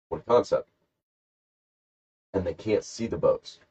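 usual speaking voice

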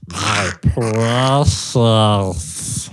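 very slow, drawn-out creature impression; hammed-up Smeagol voice; weird creature voice. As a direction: stretch each word out weirdly. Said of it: "do the weirdest voice you can do".

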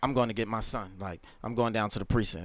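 ordinary delivery

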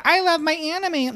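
high squeaky anime fangirl voice; excited anime fan. Retold or imitated. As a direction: squeal it like a giddy little fan girl. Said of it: "little girl who's like".

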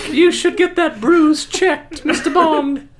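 high, slow Southern Belle drawl; sultry Southern Belle accent, laughing. Acted. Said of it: "You wanted the Southern Belle voices".

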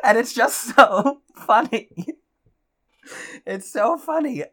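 laughing while talking; giggling through the words. Real, not acted. Laughing at the story.

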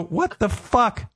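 high, slow, drawn-out disbelief; stunned outrage. Retold or imitated. Husband reading the troll's comment, high slow disbelief. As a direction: stretch it out in shocked disbelief.

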